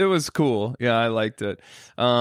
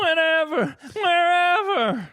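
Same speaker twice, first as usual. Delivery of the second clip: [name] voice. Retold or imitated. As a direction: belt it like [name]. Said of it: "Do the [name] voice".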